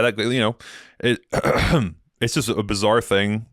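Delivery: ordinary delivery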